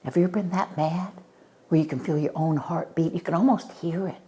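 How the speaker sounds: usual speaking voice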